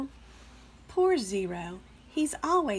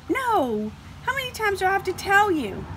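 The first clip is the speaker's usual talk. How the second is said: exasperated; 10 semitones higher, 4 dB louder than usual. Acted. Zero exasperated at guesses.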